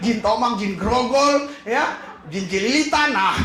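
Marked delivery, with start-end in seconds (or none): none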